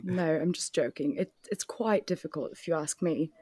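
put-on British accent; playful British voice. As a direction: carry on chatting in mock British. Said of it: "rest of the podcast in British".

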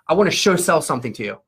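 quick, run-together "show sell"; imitating a sneaky sales line, rattled off; imitated slip-in. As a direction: slide "show sell" past as one word, fast. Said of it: "He said it just like that".

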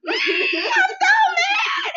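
re-enacted scream, very high and drawn out; loud, long, high-pitched mock scream. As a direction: scream it as if into a pillow. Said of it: "I screamed in the pillow".

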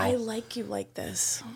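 unvoiced whisper of I like you like this. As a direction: breathe it like a creepy secret. Whispered repeat of his line.

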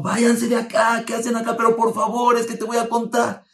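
high, loud, panicked order to leave; scared woman yelling at the girls to go; frightened shout. Retold or imitated. Frightened woman sends the girls away, shouting. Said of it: "Y ella se asustó, sabía que era peligro".

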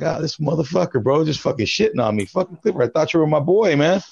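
indignant. Retold or imitated. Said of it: "And I'm like".

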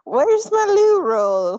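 high, slow, loud put-on British voice; drawn-out British whine for toilet paper; mock British loo roll line. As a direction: ham up a posh British plea for loo roll. Mock British quote, laughed.

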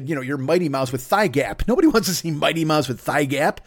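ordinary delivery

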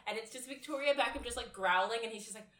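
usual speaking voice

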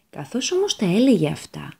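plain reading voice

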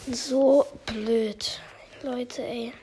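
slow whispered self-scolding; real hushed disbelief. Real, not acted. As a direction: whisper so nobody hears. Whispering while sneaking in game.

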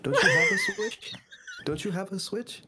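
loud stage whisper; whisper yelling. Real, not acted. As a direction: hiss it loudly across the room. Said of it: "can you stop whisper yelling at me".

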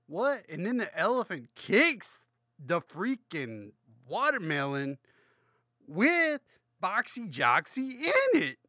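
high, loud, exasperated ranting; over-the-top high, loud disbelief at the cartoon; mock outrage. Real, not acted. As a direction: rant at the world in disbelief. Drunk mock outrage at the story.

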